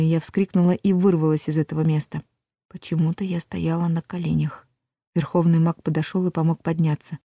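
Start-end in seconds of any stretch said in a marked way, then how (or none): none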